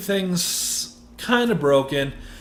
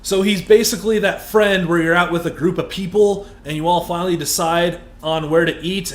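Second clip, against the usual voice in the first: usual speaking voice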